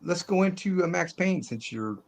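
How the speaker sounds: ordinary talk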